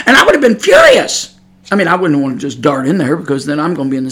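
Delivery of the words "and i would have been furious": high-pitched indignant outburst; furious insistence. Real, not acted. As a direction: pitch it way up, exasperated. Heated climax of imagined confrontation.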